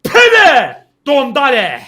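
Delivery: loud high slow cheering; ecstatic shout. Real, not acted. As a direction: roar it out in joy. Celebrating a goal.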